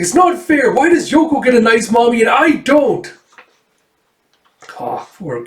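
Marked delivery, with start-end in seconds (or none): 0.0-3.1 put-on high, loud childish whine; child complaining it's not fair; upset little girl. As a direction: whine it like a hurt kid. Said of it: "It's like".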